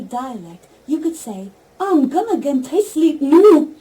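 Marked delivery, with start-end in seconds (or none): 1.8-3.8 loud, high Geordie with a big "noo"; broad Geordie, pushed up and loud; Geordie accent. Shown as a demonstration. Assistant asked to say it in Geordie, loud Geordie accent. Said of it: "In Geordie, please say".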